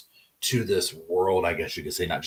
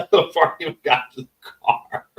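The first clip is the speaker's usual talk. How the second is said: higher, words breaking up with laughter; laughing; 7 semitones higher than usual. Real, not acted. Joking to viewers about jurors Googling, laughing through the words. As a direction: talk through a real laugh. Laughing at her own scenario.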